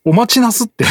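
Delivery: hammed-up yakuza greeting. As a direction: ham up the old gangster's polite opener. Repeats the haiku line, hammed up.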